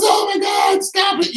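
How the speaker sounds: very high mock female cry; panicked woman. Imitating the woman yelling at the fighters, shrill panic. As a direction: shriek it like she's horrified. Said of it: "she was, she was".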